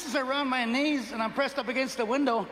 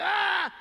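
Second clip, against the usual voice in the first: one high, hammed-up yell; mock scream; 7 semitones higher than usual. Comic screaming back at the woman in his story, high mock yell. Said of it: "And she's screaming, I'm like".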